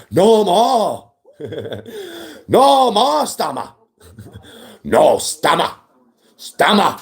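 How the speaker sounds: high, drawn-out chant-like call; hammed-up protest shout; mock rallying cry. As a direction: cry it out slowly like a slogan.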